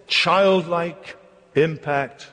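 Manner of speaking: usual speaking voice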